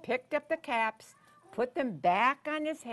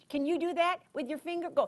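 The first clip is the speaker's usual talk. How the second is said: plain reading voice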